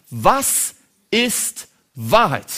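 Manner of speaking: very slow, stretched-out Was ist Wahrheit; drawn-out question. Acted. Pilate slowly asks Jesus the question of all questions. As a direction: let Was ist Wahrheit hang, slowly weighing each word.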